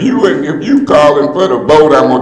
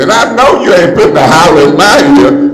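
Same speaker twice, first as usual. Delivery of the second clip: usual speaking voice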